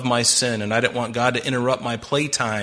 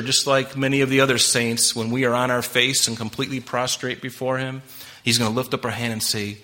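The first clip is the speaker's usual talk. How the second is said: usual speaking voice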